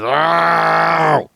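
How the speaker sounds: loud, high, drawn-out "ow"; pained yell. Real, not acted. Patient with pulled teeth to co-host, pained yell. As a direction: yelp out long and loud from sore teeth. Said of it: "that's my mouth holes yelling at you".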